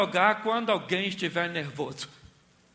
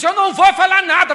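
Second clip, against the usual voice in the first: mock shouted retort; 12 semitones higher, 19 dB louder than usual. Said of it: "Aí o outro diz".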